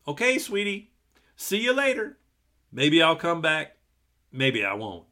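high, breezy mock daddy; careless daddy. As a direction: toss it off like a careless father. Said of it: "her daddy would have said".

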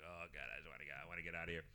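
a defeated whisper-soft thought; quiet inner groan. Retold or imitated. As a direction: groan it to yourself. Inner thought while tuned out.

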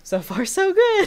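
hopeful, giddy squeak; giddy squeal. Retold or imitated. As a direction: squeal it with glee. Giddy aside reading letter.

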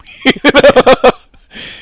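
high, loud burst of laughter; real high-pitched laughing. Real, not acted. Amused at the character's offence.

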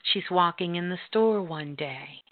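normal talking voice

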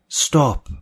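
high, pleading stop; begging. A narrator's reading. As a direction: beg him desperately. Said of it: "Natalie begged".

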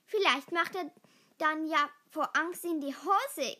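ordinary delivery

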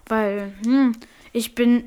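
normal talking voice

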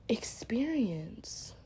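slow whispered word. Real, not acted. Finishing her thought to listeners in a slow whisper. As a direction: let the word fall to a whisper.

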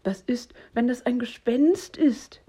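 worried half-whisper, rising; hesitant, fretful questioning; nervous little friend having doubts; anxious whisper. A narrator's reading. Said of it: "wispert Hox".

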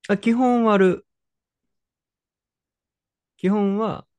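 high, loud eager answer; excited. Real, not acted. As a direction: jump in eagerly.

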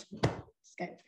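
breathy whispered just kidding; whispered joke. Real, not acted. To her viewers, taking it back in a whisper. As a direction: drop to a playful whisper on the take-back. Teasing after admitting she cried.

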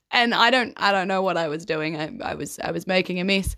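ordinary talk

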